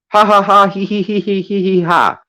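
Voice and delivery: exaggerated mocking ha-ha-ha laugh. Mocking the guy with a fake cackle. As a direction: cackle at him in mock glee.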